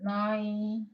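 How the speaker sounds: words drawn out with long pauses; soft, halting write-along voice; slow dictation. Shown as a demonstration. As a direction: sound out each word slowly as you write it. Sounding out words while writing them.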